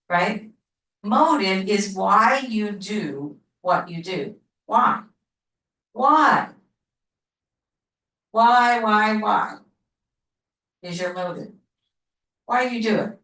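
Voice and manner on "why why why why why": WHY punched out louder, repeated with pauses; emphatic repetition. Real, not acted. Teacher drilling the class on what motive means, WHY said emphatically. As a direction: hammer each WHY hard, letting the last ones run together. Teacher hammers the key word.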